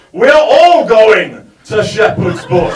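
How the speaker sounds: put-on slow, loud drunken rally cry; drunk triumphant shout. Drunk man fists aloft.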